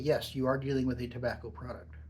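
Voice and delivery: ordinary talk, nothing marked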